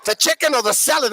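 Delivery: emphatic question in Australian twang; rough Australian waiter; broad Australian accent. Acted. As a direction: imitate the Aussie bogan waiter. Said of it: "very broad Australian accent".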